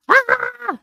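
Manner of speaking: a sudden high-pitched squeal of delight; excited squeal. Real, not acted. Greeting her live viewers with a high excited squeal. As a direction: let out a short high squeal.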